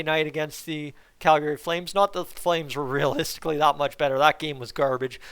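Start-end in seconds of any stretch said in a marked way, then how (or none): none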